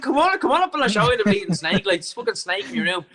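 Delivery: high, urgent pleading; frantic urging. Retold or imitated. Urging staff to come see snake.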